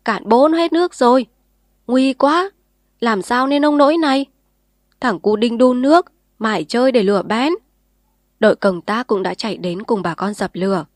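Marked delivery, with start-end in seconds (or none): 0.0-7.5 loud, higher alarmed villagers; frantic crowd at the fire; clamorous crowd shouting. A narrator's reading. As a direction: shout over each other in a panic. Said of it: "Tiếng người huyên náo".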